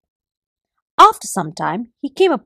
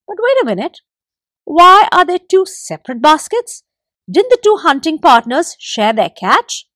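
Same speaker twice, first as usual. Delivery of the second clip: high, louder suspicious wife; wives questioning; 6 semitones higher, 6 dB louder than usual. A narrator's reading. Wives question their husbands, high and loud. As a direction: demand an explanation.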